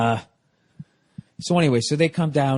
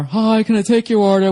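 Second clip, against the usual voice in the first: bright mock waiter voice, louder; waiter's greeting; 6 semitones higher, 5 dB louder than usual. Comedian imitating the waiter taking their order, mock bright. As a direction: play the cheerful waiter. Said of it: "a dude comes over".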